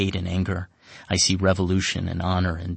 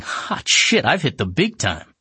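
giddy, much higher thrill; mock excitement; 7 semitones higher, 4 dB louder, slower than usual. Narrator thrilled by the promotion, excited. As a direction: gloat to yourself about the new role. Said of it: "So now I'm thinking".

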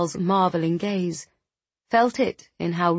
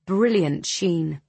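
usual speaking voice

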